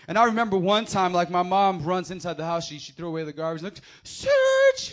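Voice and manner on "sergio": high, loud yell of a name; calling out. Retold or imitated. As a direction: shout the name in alarm. Mom calling from outside.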